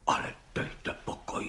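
breathless whispered amazement; astonished whisper. A narrator's reading. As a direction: whisper it in disbelief. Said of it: "užasl pan soudce".

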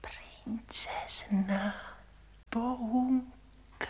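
very slow, drawn-out whisper; přehnaný šepot. Acted. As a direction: repeat it in an exaggerated hush. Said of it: "Nekřičte, to nesmí nikdo slyšet". (pomalu, protáhle šeptat)

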